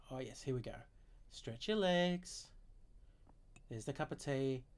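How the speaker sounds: soft murmur. Acted.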